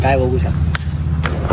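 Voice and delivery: loud, slow teasing yell; mocking shout. Real, not acted. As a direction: drag it out loud to tease. Mocking shout at friend vlogging. (जोरात, हळू चिडवणारी आरोळी)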